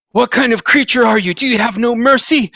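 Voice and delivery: high, forceful accusation; outraged foe confronting a merciless attacker; upset. Acted. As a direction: demand an answer from the killer, appalled. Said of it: "he seems upset".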